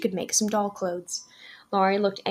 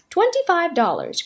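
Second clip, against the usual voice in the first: terse; 10 semitones higher, 4 dB louder than usual. A narrator's reading. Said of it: "cried a terse voice".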